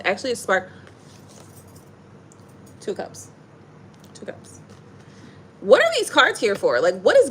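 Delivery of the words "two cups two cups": breathed very softly; whisper. Real, not acted. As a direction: murmur it to yourself. Names card under breath.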